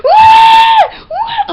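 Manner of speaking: put-on very high, loud baby "wah"; shrieked imitation of a bawling child; screeching fake wail; mock tantrum wail. Imitates adult crying like child.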